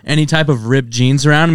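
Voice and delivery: ordinary talk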